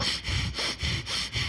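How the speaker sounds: quick angry puffs of breath, acted out; soft mock-angry panting; imitated huffing and puffing. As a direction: huff and puff as if furious. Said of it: "mad, huffing and puffing".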